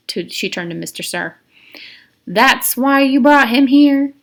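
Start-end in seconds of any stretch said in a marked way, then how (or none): 2.3-4.1 high, loud and slow question; pointed, slow query; warden's voice. Acted. Warden to Mister Sir, slow pointed question.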